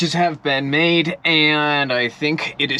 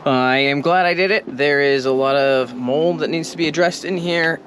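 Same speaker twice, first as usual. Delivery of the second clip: normal talking voice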